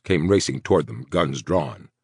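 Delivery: plain reading voice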